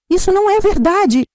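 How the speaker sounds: high, loud outburst of denial; shouting. A narrator's reading. Camila shouts at the family in denial, loud. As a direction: shout it, leaping up from the chair. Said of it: "gritou Camila".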